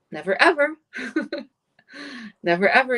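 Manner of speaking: "Never ever" said high and insistent, punching "ever"; emphatic never ever. Shown as a demonstration. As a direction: stress "ever" in "Never ever" like a firm warning. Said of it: "you don't put it like this". (emphatic "never ever")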